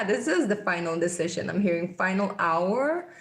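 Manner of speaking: ordinary talk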